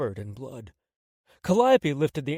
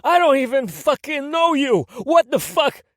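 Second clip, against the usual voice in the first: much higher, loud panicked outrage; furious shout; 13 semitones higher, 9 dB louder than usual. Acted. Tony shouts at the stranger threatening him, furious. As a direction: shout it in disbelief.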